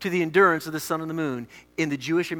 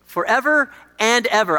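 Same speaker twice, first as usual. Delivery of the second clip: raised, forceful psalm proclamation; emphatic reading; 3 semitones higher, 3 dB louder than usual. Acted. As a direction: declare it boldly to the congregation. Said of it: "I love that emphasis".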